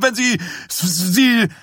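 hoch, langsam stotternd vor Wut; wütend stotternd. A narrator's reading. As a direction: bleibt vor Zorn hängen. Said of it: "Dies stotterte wütend". (stockendes, hohes Wutgestammel)

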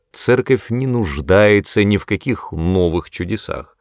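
ordinary delivery

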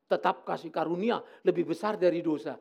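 ordinary delivery

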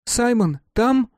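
put-on lifted, high boyish call through the door; Mark calling out. A narrator's reading.

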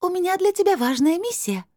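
very high, breezy otherworldly voice; unbothered crystal voice. A narrator's reading. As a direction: carry on high-pitched as if nothing happened. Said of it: "как ни в чём не бывало, продолжил голос". (высоким голосом, как ни в чём не бывало)

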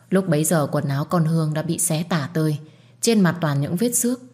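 usual speaking voice

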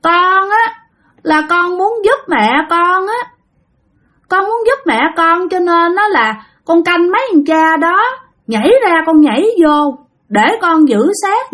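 put-on high, whining child's voice, pleading to be believed; whiny little child. Child spirit explaining herself to the old monk, high whiny kid voice. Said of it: "y chang như mấy đứa con nít nhỏ nhỏ nhõng nhẽo".

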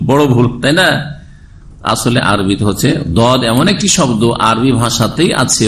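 ordinary talk

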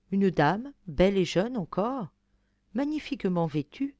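usual speaking voice

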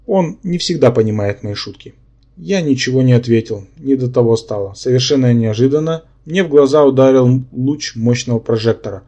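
usual speaking voice

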